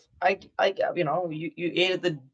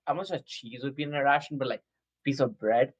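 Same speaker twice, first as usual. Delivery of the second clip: ordinary talk, nothing marked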